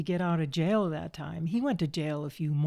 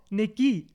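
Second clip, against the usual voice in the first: "Nicky" with the weight on the KY; stress on second syllable; 2 semitones higher than usual. Retold or imitated. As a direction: imitate "Nicky" as nick-KEE. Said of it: "the emphasis on the second syllable".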